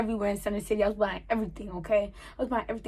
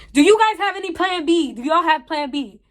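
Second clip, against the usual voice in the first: higher and louder, fed up; exasperated louder ask; 5 semitones higher, 6 dB louder than usual. Retold or imitated. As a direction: finally blurt it out loud. Clerk keeps not understanding.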